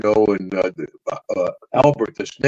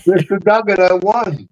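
high, proud little announcement; eager young winner; excited kid; 7 semitones higher than usual. Retold or imitated. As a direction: beam it out like a kid who just won. Kid boasting to promoter.

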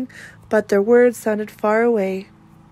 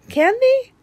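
lightly voiced little boy, much higher; hopeful child; 3 dB louder than usual. A narrator's reading. Jacob asking Mom if boys can. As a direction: ask it hopefully like a small boy.

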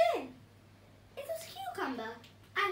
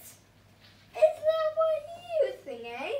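squeaky, high, loud villain sneer; hammed-up evil pea taunting from its hiding place; evil pea sniggering; 13 semitones higher, 6 dB louder, slower than usual. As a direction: snigger it like a tiny gleeful villain. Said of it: "Sniggered the evil pea".

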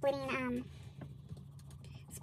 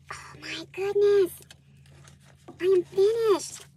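excited; 4 semitones higher, 7 dB louder, slower than usual. Real, not acted. Finishing the envelope.

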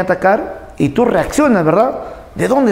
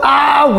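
sharp cry of pain, higher; 6 semitones higher than usual. Shown as a demonstration. Teacher showing the cry of pain on a nail. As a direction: yelp like stepping on a nail.

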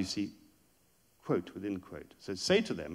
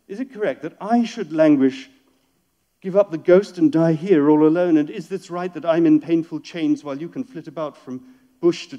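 loud, declaiming lament, voice raised high; impassioned poetry reading; 4 semitones higher, 13 dB louder than usual. Acted. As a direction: pour out the caged bird's grief to the crowd. Reads the parrot's lament aloud.

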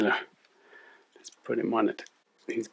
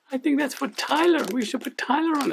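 squeaky, high cartoon puppet sidekick; chirpy little puppet, much higher. Puppet co-host Lani speaks.

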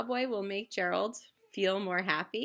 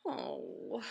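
very low, slow, heavy sigh; a gloomy giant groaning oh; sad elephant sigh; 5 dB quieter than usual. Acted. Gerald sighing sadly to Piggy, very low voice.

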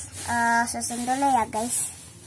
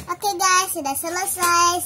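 excited; 6 semitones higher, 4 dB louder than usual. Real, not acted. Cheerful announcement to viewers.

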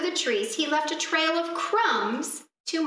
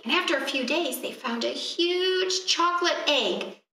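usual speaking voice

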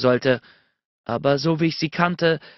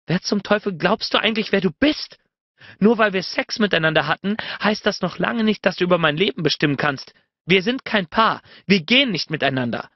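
yelling; 6 semitones higher than usual. A narrator's reading. She tears into Bennett over the flowers, yelling tirade. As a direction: rant at him, rushing through. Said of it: "schrie sie".

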